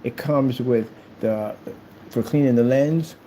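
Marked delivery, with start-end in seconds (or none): none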